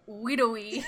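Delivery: "Widowee" sounded out as widow-ee; as spelled. Shown as a demonstration. Guessing at a town name, "Widowee" said as spelled. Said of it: "pronounce it the way it looks".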